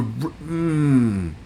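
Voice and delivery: usual speaking voice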